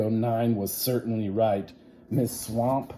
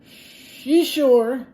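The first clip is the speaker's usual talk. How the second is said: put-on high childish chorus voice, much louder; kids' chorus; 11 dB louder than usual. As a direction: squeak it out like a class of kids. Kids answer in chorus.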